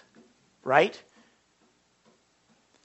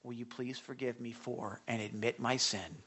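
humble plea; 8 semitones lower, 11 dB quieter than usual. Acted. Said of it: "Sorry is take it or leave it".

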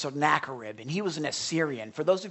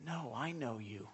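God speaking gently to his people, much softer; tender, soft; 7 semitones lower, 15 dB quieter than usual. Acted. Preacher voicing God tenderly to the congregation, soft and close. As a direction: say it softly, like a promise.

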